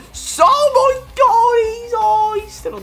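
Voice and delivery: very high, loud, drawn-out protest; high, stretched outraged cry; outraged yelling. Real, not acted. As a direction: shriek in disbelief at the referee. Outraged at referee decision.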